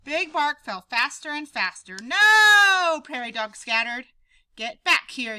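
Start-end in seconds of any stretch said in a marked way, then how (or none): 2.0-3.0 loud high no; horrified loud cry. Acted. Prairie dogs seeing Big Bark fall, loud cry. Crowd sees him falling. 4.6-5.4 loud call; yelled. Acted. Pip calling the scattering dogs back, loud yell. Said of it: "yelled Pip".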